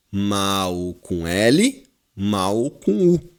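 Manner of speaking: mal com L, mal com U spelled out very slowly; slow teaching demo. Shown as a demonstration. Teacher to class, showing mal sounds the same both ways, very slow. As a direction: drag each word out for students. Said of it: "a pronúncia é a mesma".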